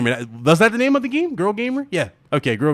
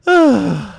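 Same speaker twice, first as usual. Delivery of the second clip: higher-pitched, sharp exhaled sigh; loud sigh; 7 semitones higher, 4 dB louder than usual. Real, not acted. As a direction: let out a big audible sigh. Nobody answers his question.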